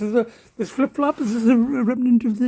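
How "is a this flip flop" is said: ordinary talk, nothing marked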